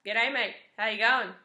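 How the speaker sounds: loud, broad Australian "G'day, mate"; broad Aussie greeting. Shown as a demonstration. Vlogger showing off her Australian accent, loud broad Australian. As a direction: ham up the Australian accent for the camera. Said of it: "awesome accent".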